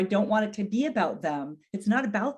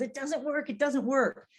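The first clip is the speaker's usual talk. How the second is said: frustrated high cry, imitated; retold angry outburst, pitched high; imitating his screaming; 7 semitones higher than usual. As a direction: mimic a husband yelling at a broken phone. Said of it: "he'd be screaming and shaking his fist".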